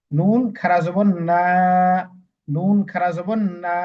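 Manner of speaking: slow spelling, final না held long; lengthened vowel. Shown as a demonstration. Teacher spelling out the standing zabar, drawn-out না. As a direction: stretch না at the end of নুন খাড়া জবর না. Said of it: "লম্বা করে পড়তে হবে".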